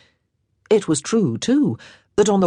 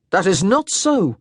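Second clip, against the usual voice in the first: indignant; 4 semitones higher than usual. Acted. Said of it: "he said indignantly".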